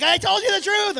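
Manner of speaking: put-on high, loud kid's complaint; protesting kid. Kid in trouble protests to parent, high and loud. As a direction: protest like a child wrongly punished. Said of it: "when your kid tells you the truth".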